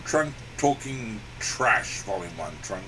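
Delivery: deep slow title read; announcer voice. Acted.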